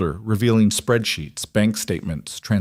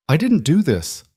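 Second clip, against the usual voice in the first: weak voice; 8 semitones higher than usual. A narrator's reading. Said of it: "hated how weak her voice sounded".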